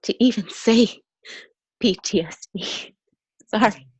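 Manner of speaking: real tearful strain, long breaks between phrases; emotional. Real, not acted. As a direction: struggle to get the words out through real tears. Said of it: "sorry, I'm emotional".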